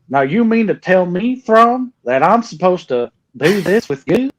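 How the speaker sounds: high, hammed-up Southern country accent, imitating the audiobook; Eli Vanto voice. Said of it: "the Eli Vanto voice".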